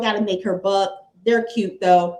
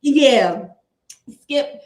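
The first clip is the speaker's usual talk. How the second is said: playful sportscaster impression; Shannon Sharpe; 5 dB louder, slower than usual. Host showing off an impression on a co-commentator's name, playfully mimicked. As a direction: call the name in a mimicked TV-debater voice. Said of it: "Shannon Sharpe impression".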